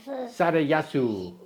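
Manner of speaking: put-on Japanese accent. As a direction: say the name in a hammed-up Japanese accent. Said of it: "What do you think of my Japanese accent?".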